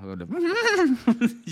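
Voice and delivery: high-pitched whinny from a car horn; imitated horse neigh. Friend telling how a car honked at his sister, horse neigh. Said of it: "le puso un sonido de, de caballo".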